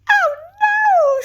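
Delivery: very high, loud frightened shriek. Acted. Little wolf hears the crash, shrieking in fear. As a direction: shriek in panic. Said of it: "shrieked the little wolf".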